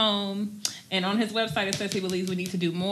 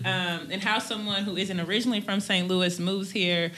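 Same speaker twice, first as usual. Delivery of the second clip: ordinary talk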